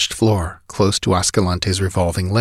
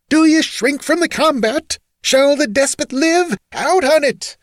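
very high, frenzied challenge; wild scream. A narrator's reading. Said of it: "screamed Rinaldo, dashing off his feathered cap, his wild eyes glaring".

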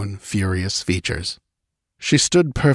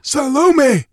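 high, louder, frantic shriek; 5 dB louder than usual. A narrator's reading. Bleeding priest shrieking for the queen from the battlefield. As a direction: scream out her name, high and frantic. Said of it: "shrieked the bloody head".

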